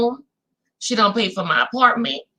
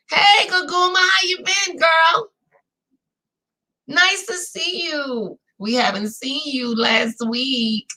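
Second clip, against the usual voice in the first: warm greeting shout; 4 semitones higher, slower than usual. Real, not acted. Greets a chat viewer by name.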